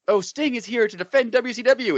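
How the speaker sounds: high hammed-up promo voice; mock announcement. Mocking the WWE line about Sting, high and hammy. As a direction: ham up the company line. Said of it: "W W E was just like".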